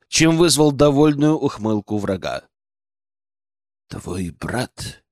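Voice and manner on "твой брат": low, hushed menacing question; quiet. A narrator's reading. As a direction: ask it quietly under your breath. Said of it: "Начал он тихо".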